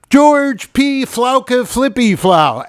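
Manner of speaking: high, loud, drawn-out announcer voice; slow, booming mock introduction; hammed-up interviewer. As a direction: belt out the mangled name like a game-show host. Retold interviewer mangling the name.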